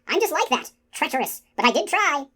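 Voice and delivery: high-pitched creature voice; high, plaintive confession; apologetic skull. A narrator's reading. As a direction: excuse yourself in a high skull voice. The skull excuses itself.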